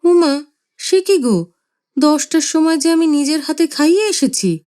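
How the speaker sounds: wife defending herself; mother taken aback; indignant surprise. Acted. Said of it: "মা বললেন".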